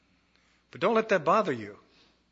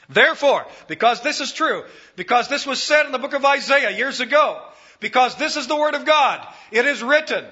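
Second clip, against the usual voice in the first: rising, louder preaching; preaching build; 9 semitones higher, 5 dB louder than usual. Acted. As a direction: drive it home like a preacher.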